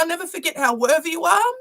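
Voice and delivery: ordinary talk, nothing marked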